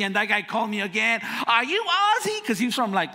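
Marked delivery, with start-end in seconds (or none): 1.5-2.4 higher, louder East Coast demand; imitating an East Coast caller asking; East Coast accent. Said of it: "he had that accent".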